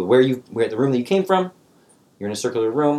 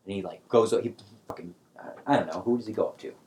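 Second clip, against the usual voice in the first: normal talking voice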